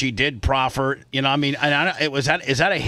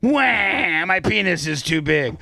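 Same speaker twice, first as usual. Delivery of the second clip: mock crybaby; 4 semitones higher, slower than usual. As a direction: fake wailing, whiny. Mock crying plaintiff.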